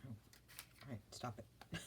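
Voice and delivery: ordinary delivery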